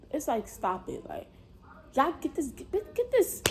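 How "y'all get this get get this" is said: high, stammered call for help; strained plea. Retold or imitated. Pinned under her, begging onlookers, high strained stammer. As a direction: squeeze it out high, struggling.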